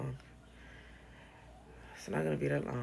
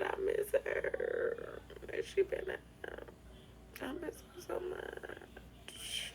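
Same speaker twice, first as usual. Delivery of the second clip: mock whisper; 13 semitones higher, 7 dB quieter, much slower than usual. Mocking fans missing her.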